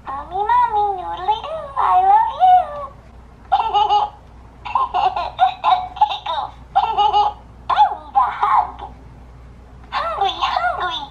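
put-on high giggling baby talk in English; a toddler doll begging for noodles; giggly baby voice.

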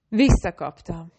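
breathless gasp of a word; panting, breathless; panted. A narrator's reading.

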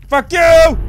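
loud, high burst of defiance; angry shout. Real, not acted. Player cursing the enemy that glued him, angry shout. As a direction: shout the curse straight at your opponent.

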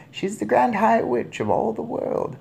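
put-on high-pitched old lady revelation; composed grandmother. A narrator's reading. Grandmother reveals witch to father, composed. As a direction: deliver the title deliberately.

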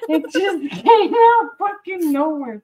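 high, loud words through laughter; laughing. Real, not acted. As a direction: laugh while protesting your innocence. Amused by own sudden accent.

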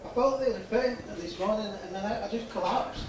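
faint patient speaking through the mannequin. Acted. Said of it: "I'll be actually the mannequin's voice today".

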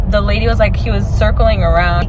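ordinary talk

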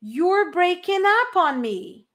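slow, clear English model; slow model sentence. Shown as a demonstration. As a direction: enunciate slowly. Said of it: "A ligação está travando". (frase modelo lenta)

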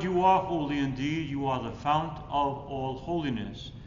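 ordinary talk, nothing marked

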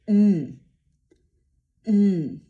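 loud, held nasal hum; humming m. Shown as a demonstration. Teacher hums the m so pupils feel vibration. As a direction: feel the buzz in the nose as you hum. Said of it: "Poczujmy wibracje".

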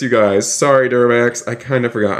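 ordinary delivery

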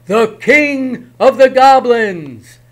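high, loud, slow cry; terrified shout. Acted. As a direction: scream the name in terror. Herschel cries out.

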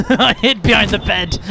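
giggling through the words; real laughing. Real, not acted. Player confesses hiding behind the bed, laughing. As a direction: laugh before and after saying it. Laughing together after surviving.

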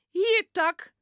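loud high call to the dog. A narrator's reading. Boy calling his dog away from the cat. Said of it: "roept Jip".

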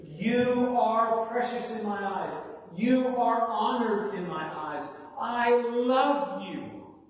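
high, loud, stretched-out proclamation; voice of Jesus. Acted. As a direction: drive each phrase home, drawing out the last. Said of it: "That is your Savior speaking to you".

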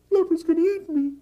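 high, loud squeaky alarm; frightened squirrel. Acted. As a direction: squirrel blurting out its danger to the wise goat. Squirrel tells Goat his fear.

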